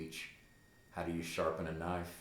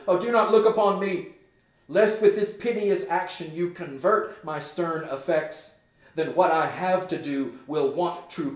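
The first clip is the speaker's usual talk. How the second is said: much higher and louder, panicked cry; Hamlet horrified; 10 semitones higher, 10 dB louder than usual. Acted. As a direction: point at the ghost in terror. Hamlet sees the ghost.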